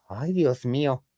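ordinary delivery

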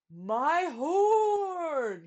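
high, loud dismayed cry; gulped cry. A narrator's reading. Trumpeter finding his horn blocked, dismayed gulp. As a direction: gasp out in dismay. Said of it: "he gulped".

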